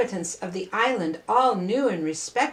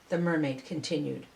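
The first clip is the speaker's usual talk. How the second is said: usual speaking voice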